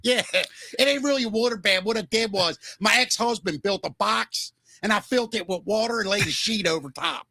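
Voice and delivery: chatty mock Baltimore trailer gal; Baltimore accent. Host as Baltimore woman explaining her water bed, mock Baltimore accent. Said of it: "the Baltimore accent".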